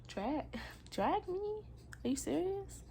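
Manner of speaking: high-pitched disbelief with a laugh; incredulous. Retold or imitated. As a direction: scoff it slowly, pitch climbing into the questions. Retelling her disbelieving reply to him.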